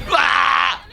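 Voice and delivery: high loud startled scream; scared scream. Real, not acted. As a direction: shriek at the jump scare. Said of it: "Don't jump scare me".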